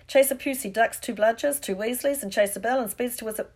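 match commentator getting carried away; sports commentary. A narrator's reading. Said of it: "Lee Jordan was saying".

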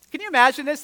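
loud, high burst of amazement; amazed exclaim. Real, not acted. Preacher marvelling to the congregation, loud and excited. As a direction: pitch it up like you can't believe it.

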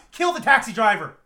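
loud villainous command; mad scientist. Acted. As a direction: order it like an evil overlord. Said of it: "Power mad".

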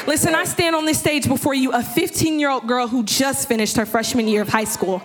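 high, driving sermon delivery; impassioned preaching. Real, not acted. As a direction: build and press it home to the congregation. Preaches at rising full force.

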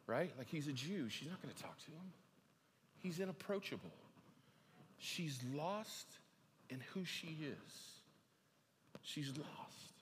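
soft, low reflective sermon voice; hushed preaching. Real, not acted. Preacher quietly imagining the lonely woman at the well. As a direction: drop the voice to paint a sad scene.

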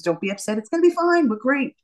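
high-pitched, upbeat brush-off of worry; cheerfully playing it down; breezy reassurance. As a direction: reassure him brightly, pitch lifted high. Reassuring her upset husband.

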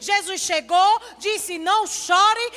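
impassioned preaching. Acted. Pastor to congregation about the impossible, impassioned preaching. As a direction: drive it home to the congregation, ringing out.

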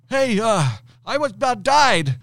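high and loud, breathless panic; panicked employee. Retold or imitated. Employee calling boss about fire, high panicked yell. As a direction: employee blurting out bad news, high, loud and frantic. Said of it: "He's like".

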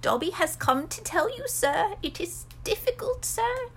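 hesitant, humble elf, pitched high; high servant voice, searching for words; little elf struggling to begin; high-pitched house elf. Acted. Plays Dobby the house elf.

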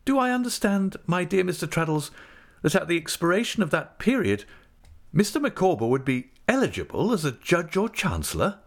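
high-pitched, courteous questioning; affable, businesslike. A narrator's reading. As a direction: confirm the terms with affable precision. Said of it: "with her most affable air of business".

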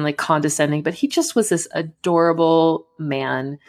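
normal talking voice

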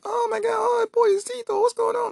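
very high, shrieky falsetto; hammed-up high scream; mock girls screaming. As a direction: imitate panicked girls squealing. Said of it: "the girls, they screaming".

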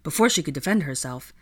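ordinary delivery, nothing marked

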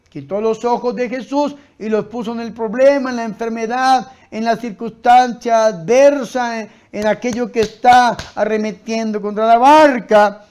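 loud, driving sermon delivery; full-force preaching. Real, not acted. Preacher warning against looking at the problem, full-force preaching. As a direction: hammer each phrase at the congregation.

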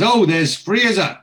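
high, loud, drawn-out acted greeting; calling out. Oz sees Ali Fraser in the bar, calling out. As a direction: call out on spotting someone you know.

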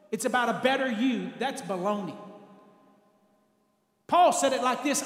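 usual speaking voice

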